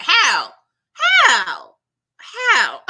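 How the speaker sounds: high, loud disbelieving how; incredulous shout. Retold or imitated. Vlogger doubting Toy will repay, incredulous shout. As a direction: yell it in disbelief, laughing at the end. Mocking Toy's promise to repay.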